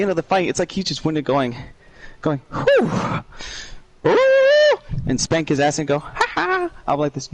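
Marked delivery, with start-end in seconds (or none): 2.5-4.8 high falsetto whoop; boss whooping. Retold or imitated. Gamer imitating the boss gloating after the fight, falsetto whoops. 6.0-6.7 high taunting ha ha; mocking falsetto laugh. Gamer imitating the boss's taunting laugh, high falsetto.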